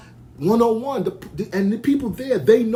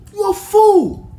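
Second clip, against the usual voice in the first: much higher and louder furious shout; angry shout; 13 semitones higher, 9 dB louder, slower than usual. Real, not acted. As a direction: explode at him. Heated rant at critic.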